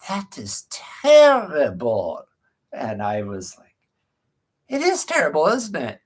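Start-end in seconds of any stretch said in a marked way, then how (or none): 0.1-2.2 imitating an old-fashioned doctor's Eastern European accent, very slow. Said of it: "kind of Eastern European accent". 4.7-6.0 much higher voice, reenacting an agreeing reply; high-pitched retold reply. Own reply to the doctor, acted.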